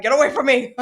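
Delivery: loud, high shove-off; mock repelled yell. Partner fending off skunk-sprayed husband, loud mock disgust. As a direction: yell it to drive someone off.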